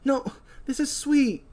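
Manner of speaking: put-on high, nervous girl apologising; flustered apology. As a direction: apologise in a jittery rush. Julie apologises to Will.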